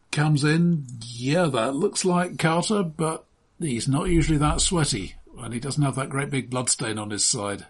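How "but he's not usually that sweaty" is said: ordinary talk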